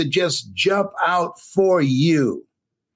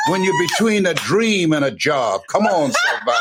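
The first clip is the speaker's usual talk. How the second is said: rising preacher's call to the crowd; preaching; 2 semitones higher than usual. Acted. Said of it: "You know that'll preach".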